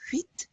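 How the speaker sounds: "Huit" with a light sounded T; pronounced final T. Shown as a demonstration. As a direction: release the T at the end of "Huit". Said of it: "We're also pronouncing this T".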